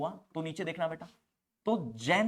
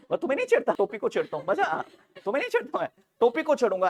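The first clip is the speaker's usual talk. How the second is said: playful boast; 8 semitones higher, 7 dB louder, faster than usual. Real, not acted. Teacher's playful boast to class.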